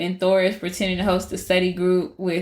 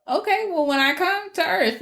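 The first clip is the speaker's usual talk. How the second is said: higher mock-stern mom warning; lightly voiced mother laying down the law; 10 semitones higher than usual. Frigg threatens Thor.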